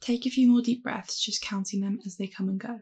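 calm, unhurried meditation voice; guided meditation. Acted. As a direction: guide them gently through the breath. Leads a mindfulness meditation.

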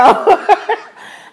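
a loud burst of real laughter. Real, not acted. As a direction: crack up at the memory. Laughing at her own story.

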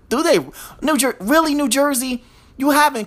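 can't believe it's New Jersey; incredulous. Real, not acted.

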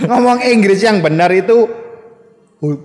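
giggling, mock-teacher voice; laughing imitation. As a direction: mimic the host's teaching style while cracking up. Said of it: "Coba kalau Papa Cang ngomong".

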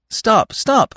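high, loud urgent plea. Acted. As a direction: rush to stop her. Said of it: "said Bambi quickly".

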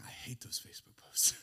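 whispered aside. Real, not acted. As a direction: confide it under breath.

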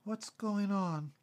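slow, high, sleepy question; half-awake, drawn-out voice; groggy. A narrator's reading. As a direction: ask it drowsily, just woken up. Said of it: "came a groggy voice".

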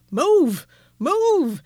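loud, high urgent yelling. Retold or imitated. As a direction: yell at him to move. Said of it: "I'm sitting there like".

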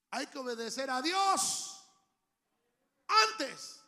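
loud, slow and rising preached line; emphatic declaration. Real, not acted. Pastor declaring God's law to the congregation, loud and slow. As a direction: drive it home to the church.

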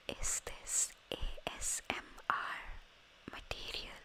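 soft breathy whisper into the new mic; ASMR whisper. Shown as a demonstration. Testing her new microphone with a whisper. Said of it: "but I just said".

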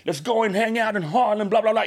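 imitando a un pana neoyorquino, más agudo; hanging out en inglés callejero, más agudo y pausado; amigo DJ en inglés. Retold or imitated. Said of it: "y Lobo". (imita a su pana DJ invitándolo a Harlem)

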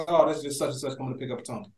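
normal talking voice

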